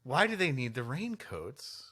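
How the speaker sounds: usual speaking voice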